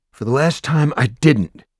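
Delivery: high, loud exasperated denial; exasperated shout. Acted. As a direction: shout it, fed up.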